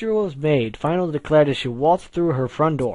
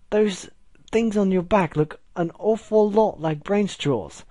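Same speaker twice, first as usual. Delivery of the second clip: high, slow British suspicion; wary and high-pitched in a British accent; 6 semitones higher than usual. A narrator's reading. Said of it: "with my British accent".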